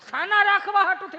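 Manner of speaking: high, loud punch on Sana; emphatic outburst. Acted. Storyteller stressing the absurd reason to the crowd: loud punch.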